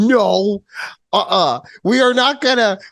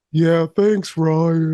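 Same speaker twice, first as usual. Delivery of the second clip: a hint of droopy, lower Eeyore gloom; mopey Eeyore; 4 semitones lower, much slower than usual. Teasing her co-host by imitating his gloomy Eeyore reply, lightly voiced. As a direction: deflate each word like a sad donkey. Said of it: "You fucking Eeyore me for Winnie the Pooh".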